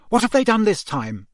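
high-pitched maternal demand; exasperated mother. A narrator's reading. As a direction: demand to know the latest mischief. Mother demands to know mischief.

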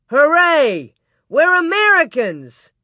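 loud, high patriotic shout; jubilant cheer. Acted. Pa jumping out of bed, cheering. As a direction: cheer it out with gusto.

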